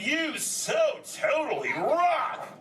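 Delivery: slow, high, stretched surfer call; drawn-out stoked turtle yell; rallying turtle cheer. Acted. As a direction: holler praise to cue the crowd. Rallying the crowd.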